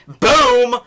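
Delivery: loud, high-pitched boom; sudden booming mock gunshot; gunshot sound effect. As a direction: shout out a gun blast. Imitating a gunshot.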